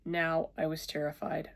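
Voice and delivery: ordinary delivery, nothing marked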